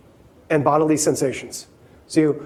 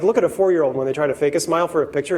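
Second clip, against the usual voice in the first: ordinary talk, nothing marked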